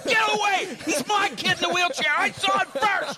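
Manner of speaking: put-on high, loud squabbling kid; possessive yelling. Son to brothers catching up, indignant shout. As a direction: yell to claim it before your brothers.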